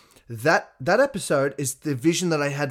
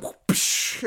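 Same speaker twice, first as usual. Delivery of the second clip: imitated whip crack made with the mouth; unvoiced whoosh into a sharp "psh"; whip sound. Podcaster imitating a YouTuber's video gag, whip sound. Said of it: "he does his whip sound".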